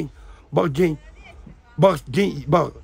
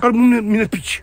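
slow and loud, every word pressed; slow insistence; 5 dB louder, slower than usual. Real, not acted. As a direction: lean on each word slowly and loudly. Drunk friend insisting.

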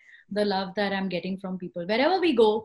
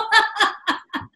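high laugh; 9 semitones higher, 3 dB louder than usual. Retold or imitated. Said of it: "she said it in a very fun way".

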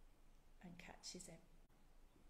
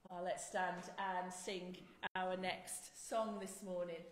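normal talking voice

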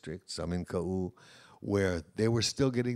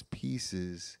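ordinary talk, nothing marked

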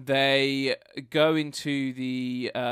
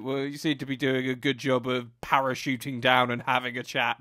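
normal talking voice

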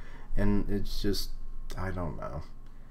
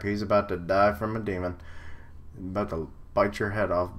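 ordinary delivery

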